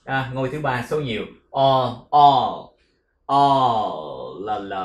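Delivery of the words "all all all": all with a long held L at the end; all, ending on the L, not on; all with a clear L. Shown as a demonstration. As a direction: stretch all out to the L. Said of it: "không đọc là on nha".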